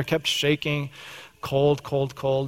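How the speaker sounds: ordinary talk, nothing marked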